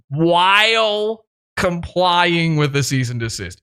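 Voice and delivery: ordinary talk, nothing marked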